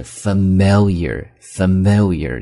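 familiar said the American way, clear r at the end; American pronunciation. Shown as a demonstration. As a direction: carefully pronounce familiar with an American r. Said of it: "美式发音的方式". (美式发音读 familiar，结尾带卷舌)

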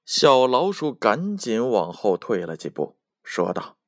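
usual speaking voice

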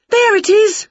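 high loud triumphant shout; spotting the target. A narrator's reading. Winnie spotting the fairy, loud high shout. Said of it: "shouted Winnie".

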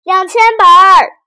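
high, very loud answer; boy shouting back. A narrator's reading. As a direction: blurt out a huge number as a shout. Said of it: "皮皮鲁回答". (孩子对妈妈大声喊出回答)

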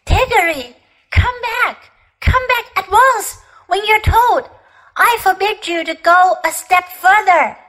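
high-pitched, half-hearted calling out; restrained shout. A narrator's reading. Trembling uncle ordering Diggory back, restrained shout. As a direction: scold from afar without full volume. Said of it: "shouting, but by no means at the top of his voice".

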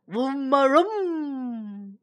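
slow, drawn-out mock trumpet call; elephant trumpet. Elephants scaring off the lion, playful trumpet call. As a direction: trumpet like an elephant raising its trunk. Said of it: "They both trumpeted".

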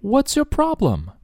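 high, exasperated what's your problem; irritated teacher demo; annoyed challenge. Shown as a demonstration. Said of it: "你什么毛病啊你".

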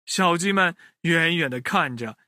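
ordinary delivery

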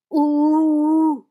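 loud, high eerie wail; long held ghostly hoot; ghostly howl. A narrator's reading. Brother reading out the cave's noises, ghostly howl. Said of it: "山洞里传出鬼哭狼嚎声".

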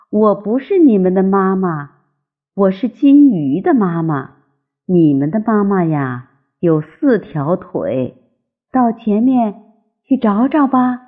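usual speaking voice

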